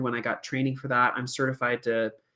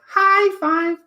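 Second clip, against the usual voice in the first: very high, loud puppet voice; puppet's encouraging voice; 8 dB louder than usual. Acted. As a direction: squeal it sweetly high like a puppet. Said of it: "she'll go like".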